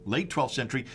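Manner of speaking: ordinary talk, nothing marked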